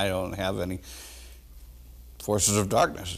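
normal talking voice

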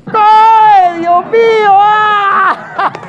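loud, high comic cry of pain; over-the-top "oh my God" shriek; mock agonised wail. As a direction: howl as if struck. Comic wail reacting to clip.

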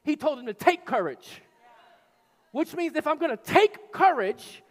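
loud, fast, high driving sermon; preaching at full force. Acted. Preacher urging the church to fight for courage, loud and driving. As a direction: push the congregation to fight.

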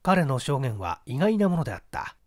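ordinary delivery

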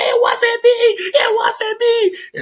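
very high, crying plea of innocence; mock wailing denial. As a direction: imitate a brother shrieking through a whooping. Mimics brother wailing while beaten.

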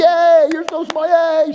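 put-on high, loud baby-praise squeal; excited cheering. Grandparents cheering the baby at the dinner table, high excited squeal.

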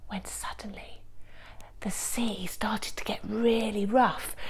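hushed, slow build before the storm; whispered suspense for children. A narrator's reading. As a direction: whisper slowly, holding the children's breath.

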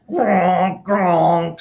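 high loud piggy grunts; two loud snorting pig squeals. Acted. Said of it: "squealed Don Cerdo".

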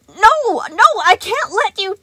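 very high, loud shrieks; panicked no's. A narrator's reading. Sun shrieking as towers fall. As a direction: shriek in alarm.

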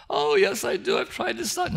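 high, fading pleading voice; tearful lady's protest. Retold or imitated. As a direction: plead it like the upset lady.

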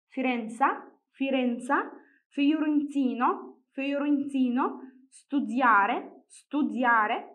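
slow Italian vocabulary drill, each word lifted at the end; word-list demonstration. Shown as a demonstration. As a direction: read each word out carefully for learners to repeat. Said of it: "نبدأ نقرأ الكلمات".

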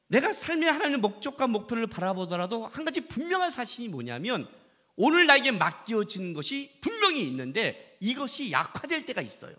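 normal talking voice

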